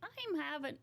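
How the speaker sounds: badly faked Brooklyn moll voice; Harley Quinn. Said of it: "You try to do Harley Quinn".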